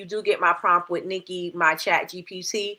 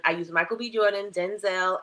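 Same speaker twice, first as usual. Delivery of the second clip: ordinary talk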